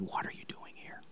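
hushed breathy whisper of God; soft, voiceless whisper to Elijah; still small voice. Retold or imitated. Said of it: "that very same sentence I heard".

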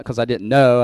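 ordinary delivery

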